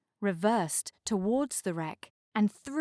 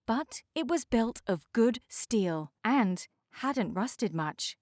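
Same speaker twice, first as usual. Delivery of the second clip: usual speaking voice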